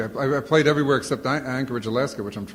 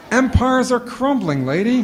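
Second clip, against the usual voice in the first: exasperated inner thought; 9 semitones higher, 4 dB louder, slower than usual. Retold or imitated. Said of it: "I'm looking at it, I'm thinking".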